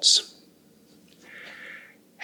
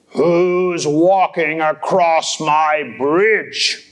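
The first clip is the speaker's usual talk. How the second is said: menacing troll; much slower than usual. Acted. Troll demanding who crosses his bridge, slow and menacing. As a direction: draw it out like a big troll.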